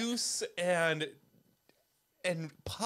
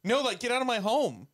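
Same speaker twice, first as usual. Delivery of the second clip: louder, higher mock alarm; mock outrage; 5 semitones higher, 5 dB louder than usual. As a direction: throw someone out in pretend horror. Mock horror at spider bite.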